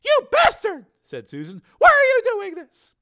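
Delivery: very high, loud female outrage; outraged woman. Acted. Susan confronting Higgins, outraged. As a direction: hurl it at him, high and loud.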